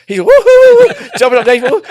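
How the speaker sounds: high, loud whooping; hammed-up falsetto woo-hoo; mock shrieking whoops. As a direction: whoop like someone with a mouse up their shorts. Mimics the teacher's shrieks.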